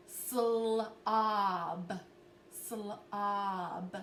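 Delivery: slob drawn out slowly, sound by sound; stretched out. Shown as a demonstration. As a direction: hold each sound of slob. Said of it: "let's stretch it out".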